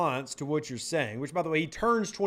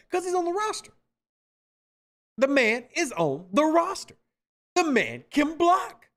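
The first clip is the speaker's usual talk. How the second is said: emphatic high insistence; 8 semitones higher than usual. Real, not acted. Host hammering his point.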